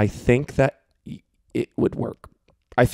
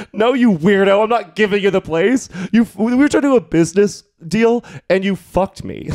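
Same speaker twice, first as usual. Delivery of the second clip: higher-pitched acted protest; mock indignation; 6 semitones higher than usual. Refusing the hookup the sublet, retold in mock indignation.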